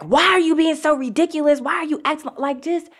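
high-pitched exasperated parent scolding; retold tirade, strained high voice; going off. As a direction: re-enact snapping at a kid, pitch shooting up. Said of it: "I would go off".

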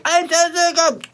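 very high, garbled mock wail; high-pitched fake baby crying; imitated crying baby. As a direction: mimic a screaming infant. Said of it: "like, she's like".

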